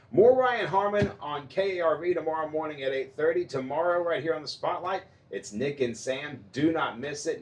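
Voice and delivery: radio promo read. Acted. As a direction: sell it like an upbeat promo.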